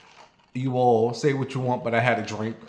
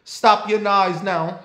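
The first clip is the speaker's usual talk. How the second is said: put-on high, louder, slow Jamaican-accented scolding; 8 semitones higher, 5 dB louder, slower than usual. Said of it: "the Jamaican man said to the dog".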